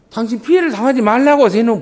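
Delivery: normal talking voice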